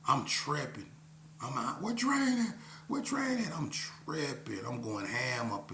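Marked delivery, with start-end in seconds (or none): 1.8-3.6 frantic calling. Retold or imitated. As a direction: call out for Train, panicked. Bursting into club, searching.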